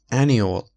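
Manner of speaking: annual said with the weight on AN, falling; stress on first syllable. Shown as a demonstration. Teacher shows students the stress in annual, first syllable heavy. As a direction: hit the AN of annual and let it drop. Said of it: "استرس سیلاب اول".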